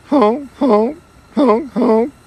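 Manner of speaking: high, loud honking like a goose; playful goose honks; goose honk imitation.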